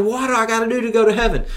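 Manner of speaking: high, fast, eager plea; excited question. Retold or imitated. Young ruler rushing up to ask Jesus, high and fast. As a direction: blurt it out, desperate to know. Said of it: "and he's so excited".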